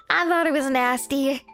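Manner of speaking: ordinary talk, nothing marked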